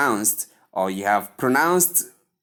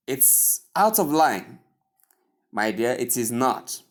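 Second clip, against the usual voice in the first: usual speaking voice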